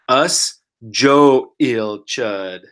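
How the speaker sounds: "Us Joe Il Chud" spelled out very slowly; slow demo. Shown as a demonstration. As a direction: give "Us Joe Il Chud" syllable by syllable. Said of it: "We're gonna say".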